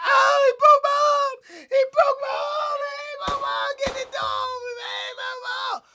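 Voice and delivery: panicked shrieking, very high and loud; acted wailing of a man with a broken arm; screaming in pain. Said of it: "he screaming so loud".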